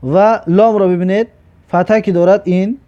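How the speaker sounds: ordinary delivery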